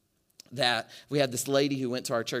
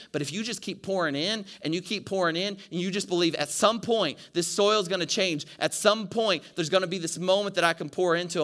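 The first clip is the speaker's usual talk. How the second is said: rising preacher's call; 5 semitones higher, 5 dB louder than usual. Acted. Pastor urging the congregation, raised higher and louder. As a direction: voice pushed higher and louder, building to the point. Sermon climax.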